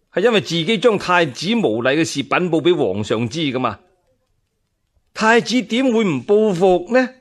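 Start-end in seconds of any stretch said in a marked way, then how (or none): 5.1-7.1 high, alarmed question; fearful thought. Acted. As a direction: ask in rising dread. (驚慌自問)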